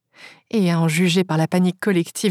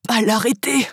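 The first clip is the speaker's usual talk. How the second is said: halting words between pauses; pained, struggling; 3 semitones higher, slower than usual. A narrator's reading. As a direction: force the words out through pain. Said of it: "comme s'il souffrait". (voix souffrante, hachée)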